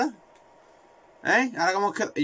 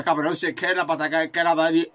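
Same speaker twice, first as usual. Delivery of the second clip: ordinary talk, nothing marked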